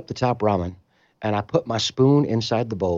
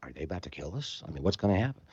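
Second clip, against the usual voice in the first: much softer, lower worried question; scared murmur; 3 semitones lower, 11 dB quieter, faster than usual. Retold or imitated. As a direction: whisper your fear to yourself. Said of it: "standing there going".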